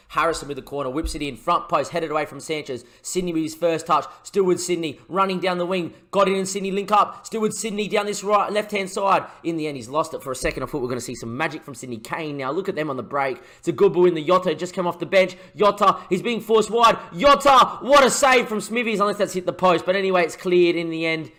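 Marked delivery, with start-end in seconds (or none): 0.1-9.2 lively match commentary, building on the chances; play-by-play commentary. Acted. Commentating the attack. 13.6-21.3 higher, louder call as the chance comes; excited commentary. Real, not acted. Near miss, commentator rises.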